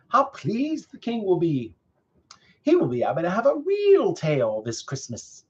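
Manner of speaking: high, delighted villager voice; gossiping villagers. A narrator's reading. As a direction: gush pleasantly over the news. Villagers chat pleasantly.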